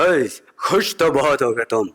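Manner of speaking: slow, drawn-out Gabbar menace; Gabbar Singh imitation. Gabbar mocks the comedian, slow hammed-up menace. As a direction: ham up the Sholay villain. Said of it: "Gabbar Singh बैठे हुए थे".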